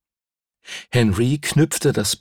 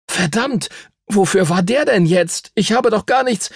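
1 empörter Junge; 11 semitones higher than usual. A narrator's reading. Henry empört nach Boxhieb.

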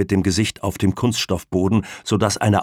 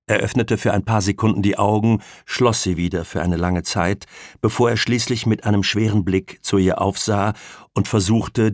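plain reading voice

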